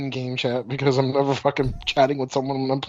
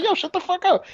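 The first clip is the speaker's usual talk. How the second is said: mock yell; 14 semitones higher than usual. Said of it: "And I'm like".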